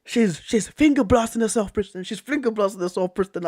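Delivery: grab your mate's attention, alarmed; imitating a shocked friend; urgent friend's whisper-shout. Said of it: "And he goes".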